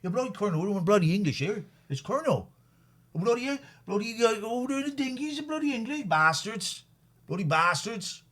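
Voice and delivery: hammed-up Cornish grumble; Cornish yokel accent. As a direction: rant at the English like a Cornish local. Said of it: "How do you talk in Cornwall?".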